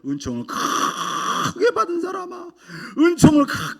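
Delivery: high, slow, awed voice calling out; a pastor voicing God's call with a break of tears. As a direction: let the divine call ring out high and slow. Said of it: "하나님의 영이 저한테".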